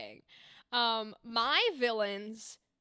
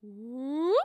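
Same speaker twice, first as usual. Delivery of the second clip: vocal slide up. Shown as a demonstration. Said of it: "I'm consciously going".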